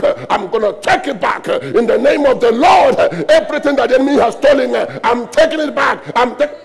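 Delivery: rising to a loud, high, defiant cry; triumphant shout. Acted. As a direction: shout it like a victory claim over the enemy. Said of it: "and then you wake up and say".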